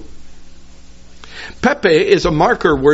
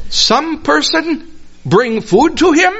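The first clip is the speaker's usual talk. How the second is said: high, slow puzzled question; 12 semitones higher than usual. Acted. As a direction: ask it baffled, voice up high. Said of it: "They're really puzzled by this".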